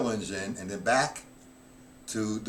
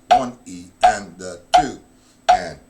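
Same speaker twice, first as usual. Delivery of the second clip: loud, slow, even counting aloud; 4 semitones lower, 8 dB louder than usual. Shown as a demonstration. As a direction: count each beat out like a drum teacher. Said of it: "So count of sixteens".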